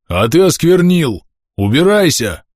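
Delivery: high, slow hostile yell; accusing shout. A narrator's reading. Villager accuses Pavel of defiling them, accusing shout. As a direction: hurl the accusation out slowly. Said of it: "крикнул кто-то со стороны".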